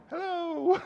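high comic greeting to the cliff; mock hello. Pastor greeting the cliff edge, mock cheerful.

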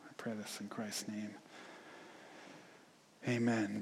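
soft, hushed pastoral prayer; quiet prayer. Acted. Pastor leads congregation in closing prayer, soft and hushed. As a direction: pray gently, sinking toward a whisper.

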